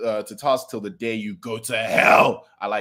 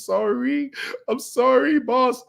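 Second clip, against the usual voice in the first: much higher, whiny fake sob; mock bawling; 13 semitones higher, slower than usual. Chisaki apologizing to his boss, mock bawling. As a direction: wail it like a crybaby. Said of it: "bawling like a baby".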